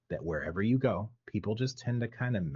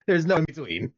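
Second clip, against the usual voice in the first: laughing; 9 semitones higher, 7 dB louder than usual. Real, not acted. Laughing at own bad questions.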